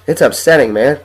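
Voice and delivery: ordinary talk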